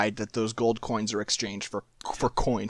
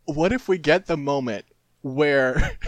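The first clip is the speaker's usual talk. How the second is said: ordinary delivery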